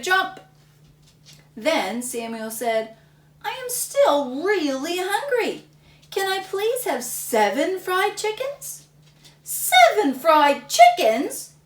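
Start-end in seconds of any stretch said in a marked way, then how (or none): 0.0-0.4 high, loud snap of a word; big chomp. Acted. Narrator ends the eating chant with a loud chomp. 3.4-8.9 put-on high, louder little boy pleading; hungry boy. Samuel begging his mom for more food, high and pleading. 9.4-11.5 slow, loud, high outraged yell; yelling mom. Acted. Mom yelling at Samuel in shock, loud and slow. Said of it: "yelled his mom".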